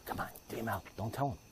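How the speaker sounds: very soft, low pleading aside; hushed aside. Pretend. Someone urging him to keep the rumor secret, hushed aside. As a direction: murmur it low, under your breath.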